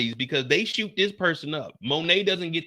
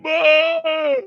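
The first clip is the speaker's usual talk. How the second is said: high mock wail; hammed-up wailing call; mock crying; 6 dB louder than usual. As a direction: wail for mama. Crying for mama.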